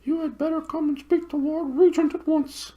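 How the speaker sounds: very high hooting owl voice, far from the narrator's own; high, hooting bird voice. Acted. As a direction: hoot it like a big owl.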